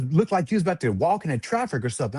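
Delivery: ordinary talk, nothing marked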